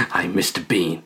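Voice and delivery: low, quiet gruff mock growl; a lightly gruff northern actor; Sean Bean voice. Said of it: "I don't think he actually talks like that".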